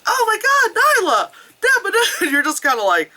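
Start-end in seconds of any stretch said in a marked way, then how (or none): 0.1-2.0 very high, thrilled squeal; hammed-up gushing imitation of an overjoyed character; Simba. Simba greeting Nala in the recording, hammed-up excitement. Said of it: "And then Simba's like".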